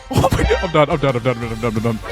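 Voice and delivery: ordinary talk, nothing marked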